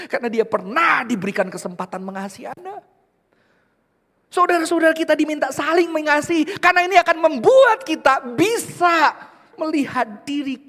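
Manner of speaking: high, loud, fervent sermon cry; preaching at full force. Acted. Pastor urging the church to love each other, loud and high. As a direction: rouse the congregation.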